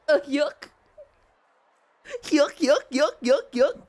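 goofy guffaw in rising bursts. Acted. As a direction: chain of silly cartoon chuckles. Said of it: "It's giving Goofy on drugs".

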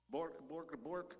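yapped bork bork bork; mock puppy barking. Shown as a demonstration. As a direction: bark it like a silly little dog. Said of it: "give me best puppy dog bark".

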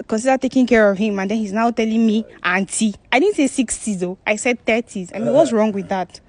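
loud, affronted complaint; indignant protest. Real, not acted. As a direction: protest loudly at the insult. Offended woman protests at being mocked.

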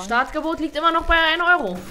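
auctioneer. Pretend. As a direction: announce the bid like an auctioneer. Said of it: "ich verkaufe heute Philipp".

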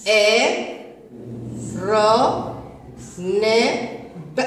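slow letter by letter spelling; sounding out letters. Shown as a demonstration. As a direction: sound out each letter slowly. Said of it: "هيا نقرأ هذه الكلمة". (تهجئة الحروف ببطء)

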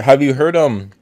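talking while chewing candy; words around a mouthful of Skittles; mouth full. Real, not acted. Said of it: "Sorry, I'm eating Skittles".

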